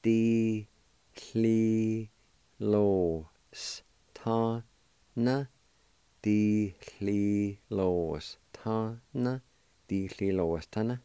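Di, chli, lo, s, ta, na, each syllable apart. Shown as a demonstration. Teacher sounding out the long word in pieces for students. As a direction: tap out Di, chli, lo, s, ta, na one at a time. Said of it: "It's pronounced like this".

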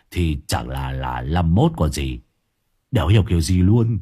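low, halting, puzzled voice; confused man. A narrator's reading. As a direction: work through it low and bewildered. Long explains his numbers.

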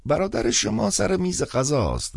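put-on high, slow old man answering; a pipe-smoking old priest informing; old man on the phone explaining. A narrator's reading.

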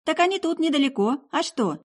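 high-pitched, merry casual reply; lighthearted girl, voice lifted high; cheerful girl. Acted. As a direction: chirp it cheerfully. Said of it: "Веселье вернулось в голос странной девицы".

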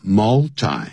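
"multi" said "MUL-tie"; "multi" rhyming its last syllable with "eye"; preferred "mul-tie". Shown as a demonstration. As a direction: end "multi" on a long I, like "tie". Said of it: "我喜欢念成multi".

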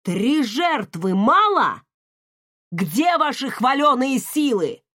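loud angry demand. Acted. Father shouts at daughter.

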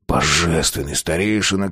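soft, mock-reverent address; quietly mock the venerable elder; sarcastic reverence. A narrator's reading. (тихо, с издевательским почтением)

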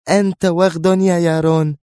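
high, indignant insult; severe. A narrator's reading. Frowning Sonia insults Yaron, severe. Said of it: "انعقد حاجباها في شدة".

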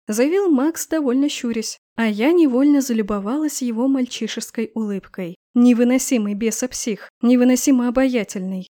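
plain reading voice

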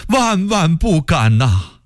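high, drawn-out servile denial; frightened underling's plea; anxious assurance. A narrator's reading. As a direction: deny it fervently to the emperor. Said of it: "曹化淳赶紧说".

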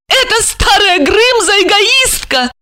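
high-pitched woman's complaint; high, bitter insult; spiteful outburst. A narrator's reading. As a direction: carry on heedless in a high, overwrought voice. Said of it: "словно не слыша его".

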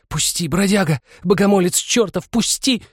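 very high, rising cry to let go; desperate, furious woman's cry; frightened angry struggle. A narrator's reading. Woman struggles free of attacker.

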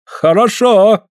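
high, slow praise; drawn-out approval. A narrator's reading. Suль nods at Andrei, praising him, drawn-out approval. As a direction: stretch the word high in approval.

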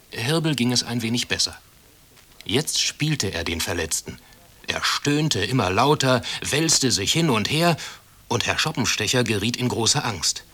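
ordinary delivery, nothing marked

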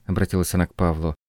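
plain reading voice

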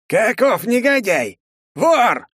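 high, slow furious shout; outraged undead cry of thief; angry skeleton shout. Acted.

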